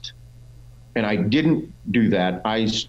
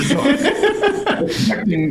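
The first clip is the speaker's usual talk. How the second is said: laughing; much slower than usual. Real, not acted. Laughs at his own excess.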